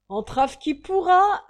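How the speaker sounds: plus haut, fort et lent; relève le défi bruyamment; lancé fort. Acted. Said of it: "fit Ragdal". (défi lancé fort et lentement)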